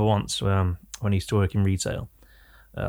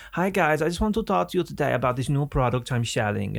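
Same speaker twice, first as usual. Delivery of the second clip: higher-pitched German salesman patter; German accent; 4 semitones higher than usual. Retold or imitated. German salesman pitching scents to shop workers, German accent. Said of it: "you look a bit German".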